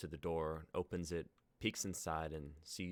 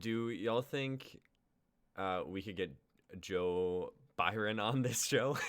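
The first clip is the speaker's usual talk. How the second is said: slow joking ask; 3 semitones higher, 7 dB louder than usual. Real, not acted. Slow drawn-out joking question.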